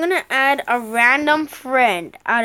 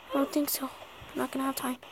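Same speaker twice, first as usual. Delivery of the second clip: muttered worried aside; under breath; 14 dB quieter, much faster than usual. Real, not acted. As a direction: say it almost to yourself. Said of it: "repeated louder".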